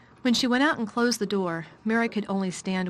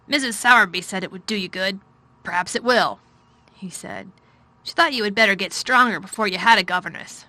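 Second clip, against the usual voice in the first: louder, weary commanding man; a tired, gloomy lord giving orders; gloomy master of house; 7 dB louder than usual. A narrator's reading. Craven speaks.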